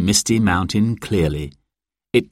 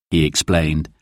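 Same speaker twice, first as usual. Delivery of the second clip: ordinary delivery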